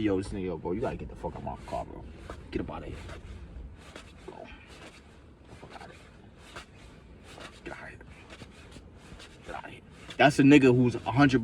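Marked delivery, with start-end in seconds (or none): 0.0-3.0 muttered quote. Retold or imitated. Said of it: "You can just get, like". 4.3-9.8 breathless whispered get outta here. Retold or imitated. Whispers to the guy in his car to get out.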